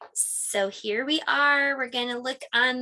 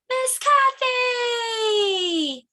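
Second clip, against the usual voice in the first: yelling; 11 semitones higher, 4 dB louder, much slower than usual. Pretend. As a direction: gasp then loud high yell. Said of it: "then you yell".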